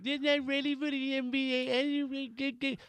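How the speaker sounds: high, slow whine; whiny voice. Retold or imitated. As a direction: drag it out in a whine. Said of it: "My whiny voice".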